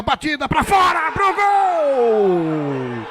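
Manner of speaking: excited call. Real, not acted. Commentator calling a shot.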